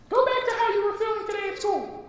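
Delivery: very high, fast fairy voice; high quick fairy guidance. Acted. Fairy guiding Maya back to school, high and quick. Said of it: "the fairy".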